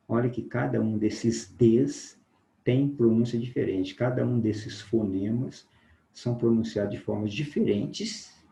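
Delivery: ordinary delivery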